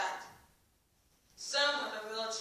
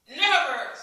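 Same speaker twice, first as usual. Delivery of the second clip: loud furious shout; angry shout; 5 semitones higher, 12 dB louder than usual. A narrator's reading. Villager refuses Ellie's plea with a furious loud shout. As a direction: bark one word at her. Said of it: "shouted the villager Ari in anger".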